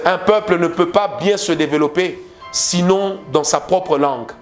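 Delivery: usual speaking voice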